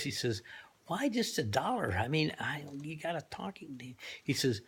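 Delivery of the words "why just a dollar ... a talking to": hushed question. Acted. Driver quietly asks the owner.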